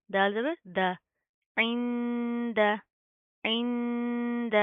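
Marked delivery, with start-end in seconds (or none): 1.6-4.5 इंद with the nasal sound held long and slow; slow Quranic recitation of इंद; nasal ikhfa. Shown as a demonstration. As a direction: hum the इंद through the nose. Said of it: "नून साकिन है ... इख्फा".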